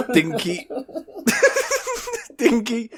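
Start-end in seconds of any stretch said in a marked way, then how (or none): none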